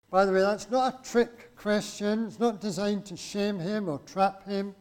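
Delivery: normal talking voice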